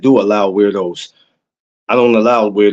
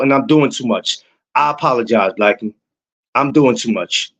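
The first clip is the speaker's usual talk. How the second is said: ordinary talk, nothing marked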